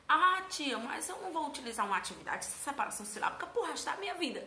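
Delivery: high, quick mock objection; pupil's protest. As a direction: play a pupil protesting to the teacher.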